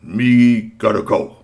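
slow Bigfoot. Acted. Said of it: "Bigfoot said".

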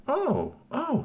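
high little oohs of delight; squeaky thrill of a toy; excited. Acted. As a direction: coo with rising excitement, very high. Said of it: "So he got all excited".

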